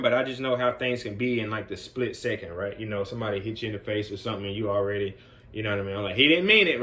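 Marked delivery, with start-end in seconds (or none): 6.2-6.8 urgent call. Retold or imitated. Calling out to stop a fight.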